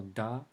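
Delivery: "да" with a hard D, no y sound. Shown as a demonstration. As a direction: say "да" with a plain hard D. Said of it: "it's a hard D".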